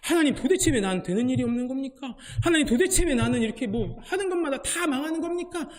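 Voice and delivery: a hint of a frustrated man grumbling upward; Bruce complaining to God. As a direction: whine at God, lightly hammed-up. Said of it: "계속해서 하나님을 원망합니다".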